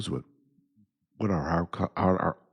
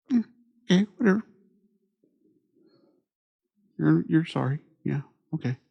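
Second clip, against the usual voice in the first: 1 sarcastic dismissal; 9 semitones higher, faster than usual. Retold or imitated. Mocking insincere apologizers.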